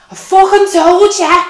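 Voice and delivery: loud high shout of vindication; shrieking gotcha; triumphant yell. Retold or imitated. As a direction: scream it in her face, told you so. Triumphant outburst at midwife.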